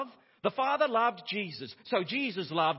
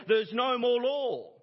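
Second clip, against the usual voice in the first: mock antinomian objection; 4 semitones higher, 5 dB louder than usual. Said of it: "those that say".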